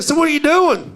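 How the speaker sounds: high-pitched friendly mock question; imitated eager tourist. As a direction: ask brightly as the oblivious tourist. Mimics same man chatting eagerly.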